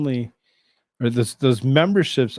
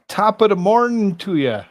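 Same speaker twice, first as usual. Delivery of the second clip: higher, louder stage-Irish greeting; a cheery mock Irish hello; hammed-up Irish brogue; Irish accent; 7 semitones higher, 6 dB louder than usual. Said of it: "maybe the wrong accent".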